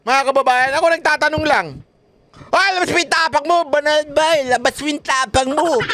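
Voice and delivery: squeaky mock dare; high mock taunting. Imitating the congressmen's dare, high and mocking. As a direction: taunt like the hecklers.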